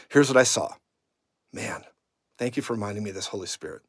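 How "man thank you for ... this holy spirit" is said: much softer, lower murmured thanks; hushed aside prayer. Real, not acted. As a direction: turn inward and thank the Spirit quietly. Aside prayer to Holy Spirit.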